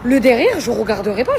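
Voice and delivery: laughing refusal. Real, not acted.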